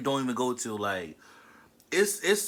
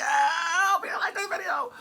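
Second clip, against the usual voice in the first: high playful squeal; 14 semitones higher than usual. Pretend.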